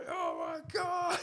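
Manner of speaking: high, slow stunned oh my God; hammed-up crowd disbelief; mock astonishment. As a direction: gasp it in disbelief. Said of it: "Everyone's going".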